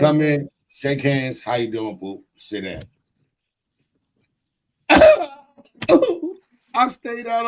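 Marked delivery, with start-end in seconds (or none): 0.0-2.8 mimicking a smooth husband. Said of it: "I'm gonna show you".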